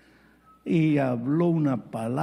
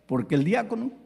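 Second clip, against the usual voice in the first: ordinary talk, nothing marked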